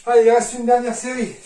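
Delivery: loud, upbeat coach call; rally the class for the last set. Real, not acted.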